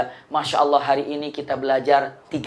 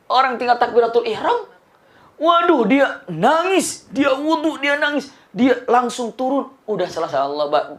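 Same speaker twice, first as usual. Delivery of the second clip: normal talking voice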